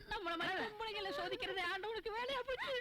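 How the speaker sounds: high, plaintive cry; tearful wail. Acted. Woman wailing that God tests women like them, tearful. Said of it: "அழாதம்மா".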